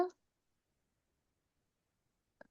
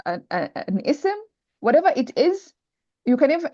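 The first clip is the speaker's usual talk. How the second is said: usual speaking voice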